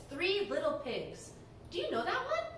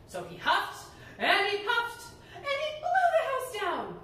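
rising to a higher, louder climax; building excitement; 7 semitones higher, 4 dB louder than usual. A narrator's reading. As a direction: build it up as the house falls. Narration builds.

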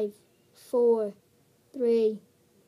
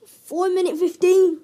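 loud; 5 semitones higher, 10 dB louder, slower than usual. Real, not acted. Announcing time loudly.

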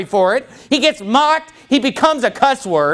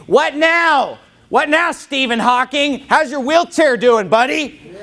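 mocking God voice; 6 semitones higher, slower than usual. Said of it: "shake it and say".

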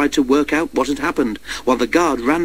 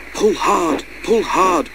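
puffed out; 5 semitones higher, much slower than usual. A narrator's reading. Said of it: "puffed Henry".

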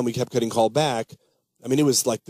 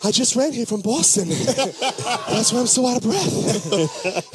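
much higher, laughing Boston tough-guy impression; Mark Wahlberg impression; 11 semitones higher than usual. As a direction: greet them like a pumped-up Boston kid. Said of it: "Mark Wahlberg".

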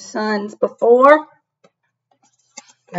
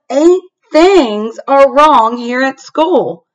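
louder, slower announcement of alarm; yelled; 5 semitones higher, 7 dB louder, slower than usual. A narrator's reading. A child reporting the wrong things, yelling. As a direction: call it out across the schoolyard. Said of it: "I yelled".

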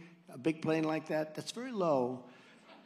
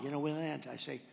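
ordinary talk, nothing marked

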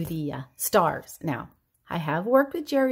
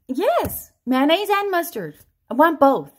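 put-on higher, louder old father insisting; old dad; 7 semitones higher, 7 dB louder than usual. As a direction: demand both like a stubborn dad. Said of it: "This is my dad".

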